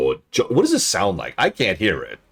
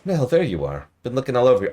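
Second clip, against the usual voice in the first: higher, louder friendly voice; bright higher companion voice; 8 semitones higher, 4 dB louder, faster than usual. Pretend.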